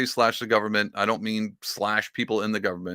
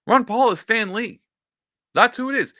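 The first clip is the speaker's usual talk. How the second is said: 1 high excited reveal; 11 semitones higher, 5 dB louder than usual. Real, not acted. Declaring Ron Paul Stan Lee.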